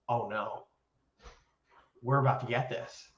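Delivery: soft, low "oh no" realization; hushed, sinking recollection; quiet retold dread. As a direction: say it under your breath, reliving the dread. Acts out dawning dread while watching.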